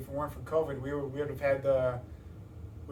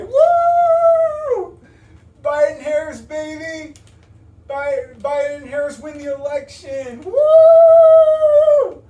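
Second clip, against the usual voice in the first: cheering whoop; 13 semitones higher, 15 dB louder, much slower than usual. Retold or imitated. Family cheering election win.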